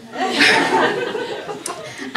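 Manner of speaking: a squeaky breathless laugh; rising silent giggle; high airy laugh. Real, not acted. Laughing at own joke.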